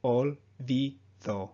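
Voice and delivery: "olvido" drawn out, with short pure O's; slow olvido. Shown as a demonstration. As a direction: stretch "olvido" and keep the O clean. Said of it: "Another example".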